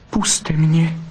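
high-pitched vixen's plea; pleading. A narrator's reading. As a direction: beg to be let go. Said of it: "zaprosila Bystrouška". (prosebně, vyšším hlasem)

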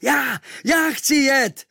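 high, slow, loud eager shout; shouting at full lung. Acted. Hero shouting to sailors that he wants to go. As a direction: yell it out with all your lungs. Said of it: "volal jsem z plných plic".

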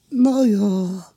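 high, sheepish admission; embarrassed mumble. Acted. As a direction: hem awkwardly as the water sprite. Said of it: "zahuhlal Žblabuňka rozpačitě".

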